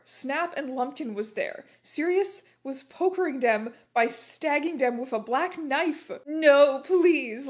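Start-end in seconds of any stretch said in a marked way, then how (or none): 6.2-7.5 loud, hammed-up plea; begging. A narrator's reading. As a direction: plead for your life, drawn out and overdone. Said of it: "Lumpkin begged".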